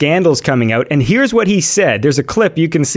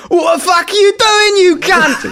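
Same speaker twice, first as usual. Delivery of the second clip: shouted Cockney geezer voice, much higher; mock Cockney. Gamer mocking friends in a Cockney accent, hammed-up shout. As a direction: bark it like an angry Cockney lad. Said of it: "[name] trying to do a Cockney accent".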